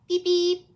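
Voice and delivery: high, loud toy-car beep; cheerful horn noise. Acted. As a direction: honk like a little car.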